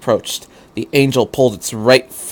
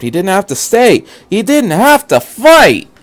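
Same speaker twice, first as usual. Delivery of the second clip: building desperation; 9 semitones higher, 5 dB louder than usual. Acted. Shinji's rising panic.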